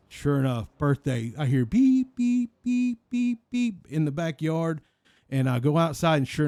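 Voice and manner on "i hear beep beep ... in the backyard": truck reverse beeps. Retold or imitated. Son hearing the eighteen-wheeler back in, high mock beeping. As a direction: imitate the truck's backup alarm.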